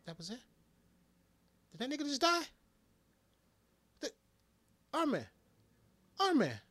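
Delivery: high, loud disbelief at the screen; can't believe he's gone; shocked disbelief. Real, not acted. Fan reliving the death scene, shocked and high.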